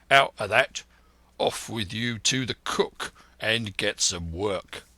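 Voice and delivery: firm ship's-captain order; a hint of a stern captain; captain barking an order. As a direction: send the boy off sharply. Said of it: "he cried".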